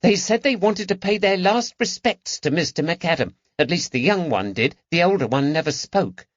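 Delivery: put-on high, youthful boy's voice; a stable lad bursting with news; eager boy. A narrator's reading.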